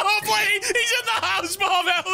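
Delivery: high, loud frantic begging for help; terrified high cries as the monster breaks in; panicked pleading. Real, not acted. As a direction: scream for your teammate in real panic. Bigfoot attacks in the house.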